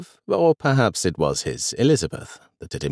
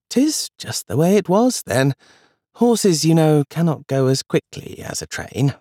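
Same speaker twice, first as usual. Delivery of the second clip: lightly raised, patient granny voice; a hint of grandmother; 8 semitones higher than usual. Grandmother answers.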